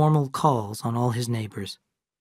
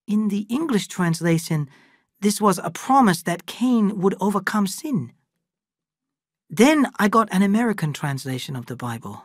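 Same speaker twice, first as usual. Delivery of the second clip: lightly raised voice of Lee, calm and knowing; Lee character voice; 6 semitones higher than usual. Acted. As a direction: Lee speaking matter-of-factly to the old friend. Lee explains the translations.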